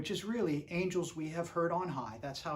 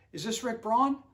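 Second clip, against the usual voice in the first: put-on [name]'s Pittsburgh accent; 6 semitones higher, 6 dB louder than usual. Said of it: "He talks like this 'cause he's from Pittsburgh".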